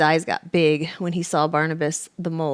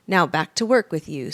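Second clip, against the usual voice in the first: ordinary delivery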